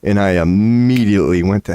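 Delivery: usual speaking voice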